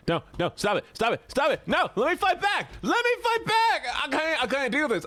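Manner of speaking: high-pitched panicked protest; real panic; frantic yelling. Real, not acted. Streamer beaten up in game.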